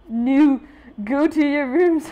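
high, loud bossy order; bossy sister. Acted. Older sister ordering siblings to their rooms, loud and bossy. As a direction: bark it at the kids.

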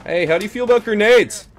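loud, high, quick taunting question; calling out. Pretend. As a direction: holler it across to the enemy. Calling out to enemies in game.

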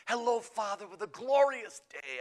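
high, loud, sing-song cheer; hammed-up joyful nun; effusive greeting. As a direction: gush it out, drawing the words long.

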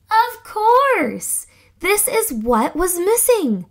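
high, bright eureka cry; excited realization. Acted. Scout realizing the missing ingredient, excited. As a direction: shout it like a sudden idea.

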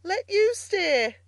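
mock-laughing, high, slow, loud incredulity. A narrator's reading. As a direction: throw it back high and drawn out, amused.

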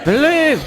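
high sharp yelp of annoyance; sudden high curse; pained yelp. Real, not acted. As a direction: cry out as you get hit. Hit by enemy in game.

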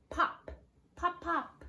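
high popping sounds; cork pops. Acted. Teacher pops like a cork for the kids. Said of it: "He can sound like a cork".

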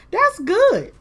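suddenly high and loud, delighted; excited. Real, not acted. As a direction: cheer it like a win. Base schedule finally works out.

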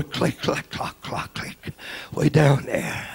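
soft whispered click, click; whispered key clicks. Retold or imitated. Preacher miming the jail keys turning, whispered. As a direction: whisper the lock turning far below.

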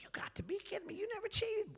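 high whispered astonishment; disbelief. Retold or imitated. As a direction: whisper it like you can't believe your ears. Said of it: "I'm just like".

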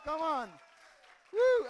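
high, loud whoop of joy; excited cheer. Real, not acted. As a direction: shout it out in triumph. Healing success, cheering.

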